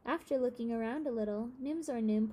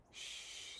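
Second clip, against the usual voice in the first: soft hiss to hush; old man shushing the boy; 14 dB quieter than usual. A narrator's reading. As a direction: hush him quickly. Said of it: "said the old man".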